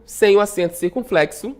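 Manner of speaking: ordinary talk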